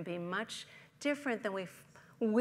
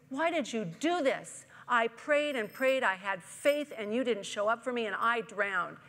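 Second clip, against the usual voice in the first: angry complaint; 3 semitones higher, 8 dB louder than usual. Acted. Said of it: "he was mad".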